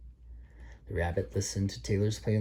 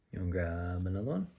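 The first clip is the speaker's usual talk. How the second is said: ordinary delivery, nothing marked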